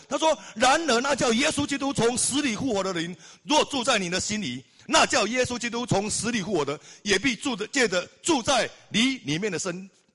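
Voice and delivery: high, loud, fervent sermon voice; rousing pulpit preaching; fiery preaching. Acted. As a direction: preach it out to the congregation. Preacher at full force.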